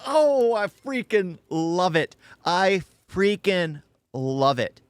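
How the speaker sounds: slow, savoured burst of delight; emphatic delight. Real, not acted. Reactor to viewers after the clip, emphatic delight. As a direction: relish it, drawing each word out slowly.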